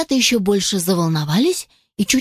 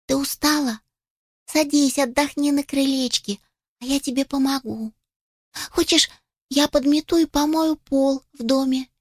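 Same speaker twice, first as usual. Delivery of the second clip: caring little chick; 5 semitones higher than usual. Acted. Youngest gently offers help.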